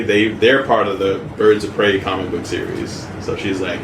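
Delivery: ordinary delivery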